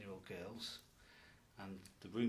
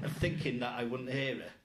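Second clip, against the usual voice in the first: laughing loudly while speaking; giggling through the line; 3 semitones higher, 9 dB louder than usual. Real, not acted. Laughing while retelling a funny story.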